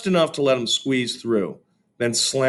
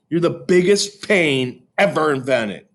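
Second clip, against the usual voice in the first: mildly raised angry yell; 6 semitones higher, 4 dB louder than usual. A narrator's reading. Peter yelling at his brother, mild angry yell. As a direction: snap it at the little brother. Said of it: "I yelled".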